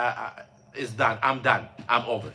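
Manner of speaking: ordinary delivery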